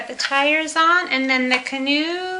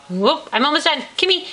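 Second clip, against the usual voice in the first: usual speaking voice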